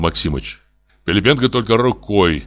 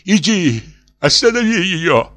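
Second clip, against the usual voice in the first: high, desperate plea; imploring; 11 semitones higher, 3 dB louder, slower than usual. A narrator's reading. Professor implores plumber to stop her, desperate begging. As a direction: beg urgently at high pitch. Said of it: "взмолился профессор".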